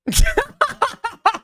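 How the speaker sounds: sudden high, loud cackle of laughter; burst of laughter. Real, not acted. Reacts to absurd clip.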